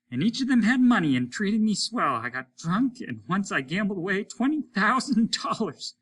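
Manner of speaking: high, loud bragging of wives and money; boastful reminiscing. A narrator's reading. Lesper brags to the others over the radio, boastful. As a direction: relive the good times with swagger.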